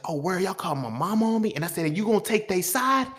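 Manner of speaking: high, indignant yelling at his mother; angry outburst. Retold or imitated. To his mama who pulled up, high indignant yell. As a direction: accuse her of taking sides.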